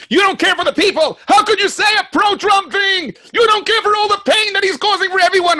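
high, loud, furious accusing yell; angry shout. Retold or imitated. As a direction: yell it at him like an outraged heckler. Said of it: "and goes".

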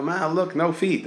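bright high kid's call, lightly voiced; kid calling to mom.

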